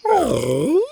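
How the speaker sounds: high whimpering oh; a pup's high little whine; whining dog. A narrator's reading. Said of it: "Buck whined".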